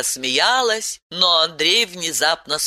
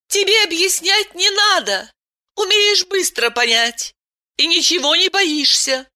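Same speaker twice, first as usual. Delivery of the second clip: Aylen's voice; 13 semitones higher than usual. A narrator's reading. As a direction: voice her gently, well above your own.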